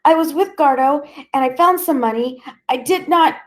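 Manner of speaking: high, loud and rushed; frantic pleading. Acted. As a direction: babble in terror. Boy sobbing under interrogation.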